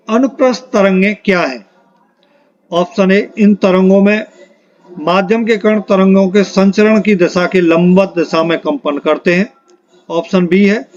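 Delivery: ordinary talk, nothing marked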